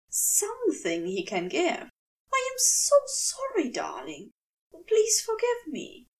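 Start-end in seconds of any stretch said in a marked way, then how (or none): 2.3-3.7 high, fussy, apologetic angel voice; a bewildered gentleman pitched high; flustered angel. Acted. Aziraphale apologising in dream. 3.7-4.3 soft darling. Acted. Aziraphale apologising in dream. 4.9-6.1 high, fussy, apologetic angel voice; a bewildered gentleman pitched high; flustered angel. Acted. Aziraphale apologising in dream.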